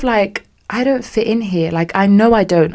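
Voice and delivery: usual speaking voice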